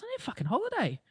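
high, squeaky imitation of an exhausted friend; quoting a worn-out friend. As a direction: pitch way up, mock-desperate. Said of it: "She goes".

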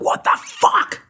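high, strained shriek without extra volume; retold outburst. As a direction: act out losing it on waking. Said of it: "I lost my shit".

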